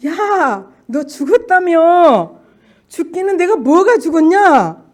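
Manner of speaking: loud, high, slow taunt; mocking Satan. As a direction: jeer like the devil, drawing words out. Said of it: "나를 조롱하는 사단의 소리가".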